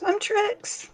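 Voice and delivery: cheerful introduction as Trix; high little character voice; Trix's voice. Acted. Player voices her character Trix.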